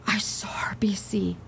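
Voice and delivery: frightened whispered gasp of a sighting; scared whisper. Retold or imitated. Delivery man whispering to the brother after seeing the ghost, frightened. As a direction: breathe it out in shock, unvoiced. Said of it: "the guy is scared to death".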